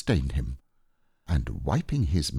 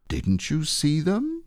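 a little surprised; 9 semitones higher than usual. Acted. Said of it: "a little surprised".

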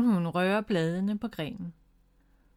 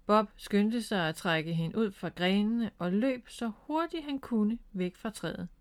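ordinary delivery

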